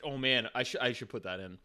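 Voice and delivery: usual speaking voice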